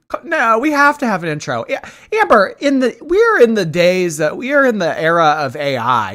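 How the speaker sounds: high, loud, fast pleading; excited insistence. Real, not acted. Friend insisting Amber make an intro, high and fast. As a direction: push hard, almost laughing.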